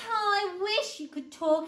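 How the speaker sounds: high, loud wistful sigh; storybook sigh of longing. A narrator's reading. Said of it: "Eddie sighed again".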